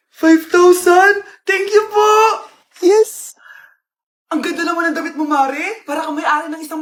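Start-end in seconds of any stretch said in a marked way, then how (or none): 0.1-3.4 put-on overjoyed kid getting money; thrilled. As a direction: celebrate a cash gift out loud. Child thrilled by gift money.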